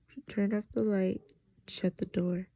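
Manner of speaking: usual speaking voice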